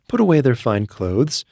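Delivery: usual speaking voice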